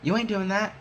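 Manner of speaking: high, sharp mock-stern no way; firm refusal. Blocking the twelve-year-old at the door, high firm refusal. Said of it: "'cause I'm like".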